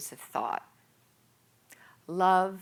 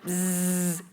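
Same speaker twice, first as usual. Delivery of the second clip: loud buzzing alarm imitation; buzzing alarm noise; 5 dB louder than usual. Imitating the dreaded alarm clock, a loud buzz.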